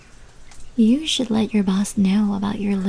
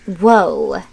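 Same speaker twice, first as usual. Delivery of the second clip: startled whoa; 7 dB louder than usual. Acted. Startled by someone at the door.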